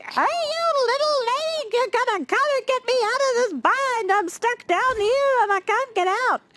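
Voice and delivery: put-on high, strained old man pleading; an old man begging the lass for help; old dude voice. Imitating the old dude asking the little lady for help, high and strained. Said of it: "this old dude down here was like".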